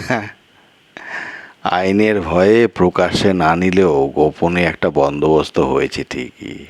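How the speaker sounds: ordinary delivery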